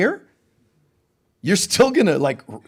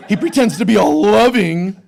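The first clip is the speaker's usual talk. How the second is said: mock outrage laughing; 3 semitones higher, 6 dB louder than usual.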